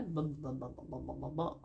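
low, soft mumbled syllables; mock mumbling. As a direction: imitate Dutch mumbling, softly. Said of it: "we kunnen mompelen". (mompelend nadoen, zacht en laag)

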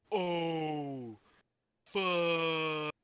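long, drawn-out mock slow-motion curse; drawn-out swear. Retelling Ralphie dropping the bolts, drawn-out swear. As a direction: stretch each word out in slow motion.

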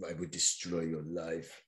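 understated movie-villain menace; mock villain threat. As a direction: threaten quietly like a film hitman. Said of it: "I don't know what accent that was".

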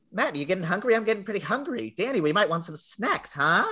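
hint at the snacks like a lightly voiced tough kid; mock bully hint. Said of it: "and Blake's like".

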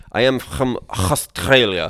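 slow, loud, throaty mock Middle Eastern accent; hammed-up guttural Middle Eastern accent with kh sounds. Imitating a man claiming to be Australian, thick mock Middle Eastern accent. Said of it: "but he says it like".

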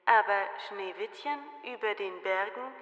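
leise Spiegelstimme; Spiegel antwortet. A narrator's reading. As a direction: leise und geheimnisvoll verkünden. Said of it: "Da antwortete der Spiegel". (leise Spiegelstimme)

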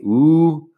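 a long held U vowel. Shown as a demonstration. Teacher showing long U to learners, long U. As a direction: hold the U long. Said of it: "la U larga".

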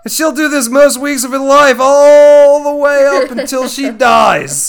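loud, high mock Aussie wildlife narrator; Australian accent. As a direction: ham up an Australian nature-show voice. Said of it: "Laughs in Australian".

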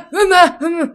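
a father woken at night shouting for his wife; startled dad calling. Retold or imitated. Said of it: "Y mi papá de que".

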